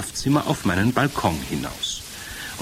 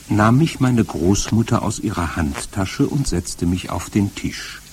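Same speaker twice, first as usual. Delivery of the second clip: ordinary delivery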